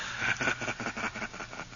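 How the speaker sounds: soft breathy laugh from somewhere unseen; a hidden presence quietly mocks him; ghostly whispered laugh. Mocking ghost laughs softly at the doctor's certainty.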